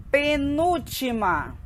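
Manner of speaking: deliberate teacher drill; slow syllable-by-syllable. Shown as a demonstration. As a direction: sound out the term slowly for learners. Teacher matching terms slowly.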